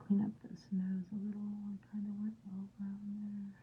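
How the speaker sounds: quick, soft whisper to herself; fast whispered self-talk over the brush. Real, not acted. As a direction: rush it out under the breath. Whispering to herself while painting.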